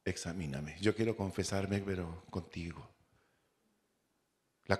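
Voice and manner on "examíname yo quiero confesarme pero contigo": soft, low, intimate plea; quiet prayer. Acted. Believer praying sincerely to the Lord, soft and low. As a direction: murmur it as a private confession to God. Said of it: "le decimos".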